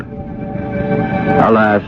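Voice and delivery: usual speaking voice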